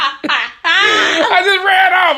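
high, slow laughing through the words; can barely get it out for laughing; giggling at his own story; laughing hard. Real, not acted. Laughing while retelling embarrassment.

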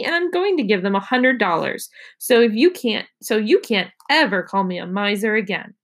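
ordinary delivery

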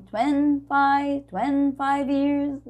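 twenty-five run together as "twenfive", slow; linked twenty-five. Shown as a demonstration. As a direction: drop the T: "twenfaiv years". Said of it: "a gente vai dizer".

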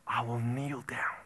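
hushed, reverent whisper. Acted. Preacher whispering the verse to the congregation. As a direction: breathe the words in awe. Quoting scripture softly.

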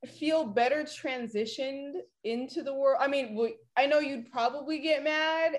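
normal talking voice

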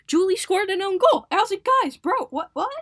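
high and loud, disbelieving; stunned outburst; outraged disbelief. Real, not acted. Outraged at own goal.

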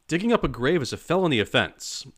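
high, loud, indignant protest; indignant shout. A narrator's reading. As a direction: shout the accusation. Groundskeeper protests loudly.

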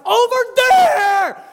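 high, slow yell across the gym; coach shouting. Retold or imitated. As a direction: bellow the direction at the players. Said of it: "I'm like".

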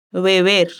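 "Beber" with both B's barely touching the lips; soft lip B. Shown as a demonstration. Teacher demonstrating a soft B: "Beber" with the lips barely touching. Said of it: "lo hace casi sin tocar los labios".

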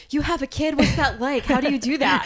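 high, loud, incredulous questions; bursting with amazed curiosity; amazed questioning. Retold or imitated. Retold amazed questions to a new mom.

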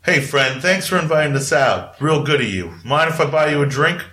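friendly lady orc. Acted. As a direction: offer the drink like a cheerful orc woman. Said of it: "And she's like".

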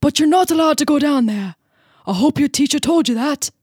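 put-on amateur English accent drifting into Irish, loud; English accent turning Irish. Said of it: "sounded a little bit more like Irish".

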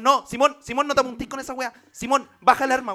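hammed-up shout. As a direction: yell at him to drop it. Mock standoff escalates.

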